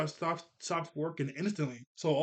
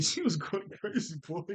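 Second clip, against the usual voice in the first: amused, laughing through the words; 3 semitones higher than usual. Real, not acted. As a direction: let a laugh break into the remark. Amused after imitating the kicks.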